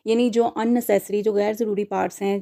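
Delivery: ordinary talk, nothing marked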